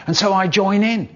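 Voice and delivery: plain reading voice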